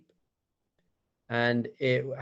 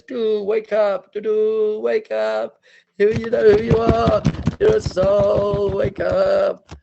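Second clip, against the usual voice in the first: high, loud, slow trumpeting call; shofar blast; 9 semitones higher, 10 dB louder, slower than usual. Acted. Shofar waking the sleeping souls, loud high blast. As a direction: blare the words like a ram's horn. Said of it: "the shofar is".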